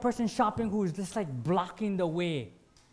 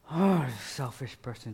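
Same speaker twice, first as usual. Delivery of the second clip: normal talking voice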